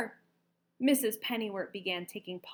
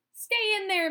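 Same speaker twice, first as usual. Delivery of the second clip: high, loud frantic shout; much higher and louder frantic yell; 11 semitones higher, 8 dB louder than usual. A narrator's reading. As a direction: yell at the hatching chicks. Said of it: "shouted at the unhatched chicks".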